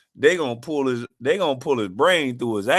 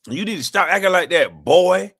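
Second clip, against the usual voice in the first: mock scolding; 5 semitones higher, 5 dB louder than usual.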